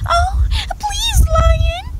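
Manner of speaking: very high, pleading little mouse. A narrator's reading. Caught mouse begging the lion, high pleading voice. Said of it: "said the mouse".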